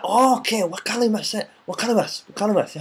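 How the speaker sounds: light up as it finally clicks; eager realization. Shown as a demonstration. Acting out finally understanding.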